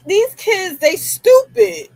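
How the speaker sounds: high, drawn-out scornful; exasperated contempt. Real, not acted. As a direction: spit out the contempt, stretched. Mocking the killers.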